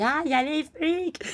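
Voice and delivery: high, slow alarmed cry; mock panic. Friends hear the doorbell at the party, panicked cry.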